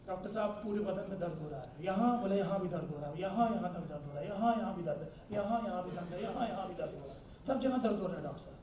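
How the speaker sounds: low, soft patient's complaint; acted sing-song moan about pain everywhere; aching patient. Patient lists aches to the doctor, plaintively. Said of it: "गया था doctor साहब के पास मिलने के लिए".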